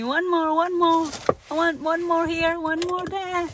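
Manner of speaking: high sing-song mimicry of a begging kid; high-pitched mock child's wheedling; imitating child's pleading. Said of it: "That's what you always say".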